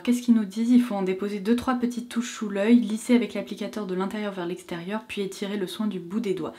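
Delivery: usual speaking voice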